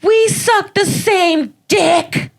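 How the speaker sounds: high, slow mocking girl voice; mocking heckler voice. Retelling a heckler's comeback, mocking high voice. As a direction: imitate the heckler in a silly high voice. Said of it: "this girl yelled back at me".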